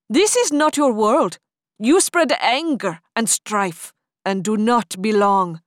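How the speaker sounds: loud, commanding rebuke of a demon; stern hero. Acted. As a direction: stand firm and scold the demon. Hatim confronts the demon.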